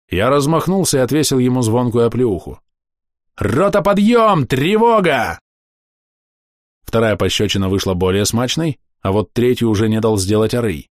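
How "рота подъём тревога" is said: bellowed barracks alarm call; army wake-up shout. Acted. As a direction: roar it like a drill sergeant.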